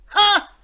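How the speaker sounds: very loud, high mocking "ha"; one explosive gloating shout; triumphant "ha". Pirate snatching the sunnies back, gloating loudly.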